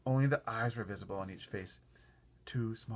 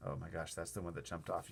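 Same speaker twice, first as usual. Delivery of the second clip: usual speaking voice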